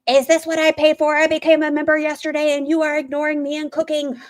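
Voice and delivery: mock the furious viewer yelling in caps; angry caps rant. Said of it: "in all caps".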